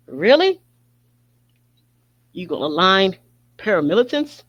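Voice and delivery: high, loud disbelieving Really; a sharp, loud, high-pitched Really; incredulous. Real, not acted. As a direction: fire it off in sheer disbelief. Incredulous outburst at paramilitaries.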